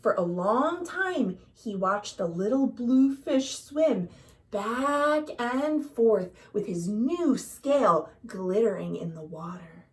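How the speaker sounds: usual speaking voice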